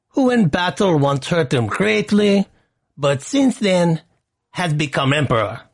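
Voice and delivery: put-on high, slow, heavy Russian accent, fading toward the end; Russian accent as Villanelle. Host quoting assassin Villanelle's perfume line, in Russian accent. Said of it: "I don't do a Russian accent very well".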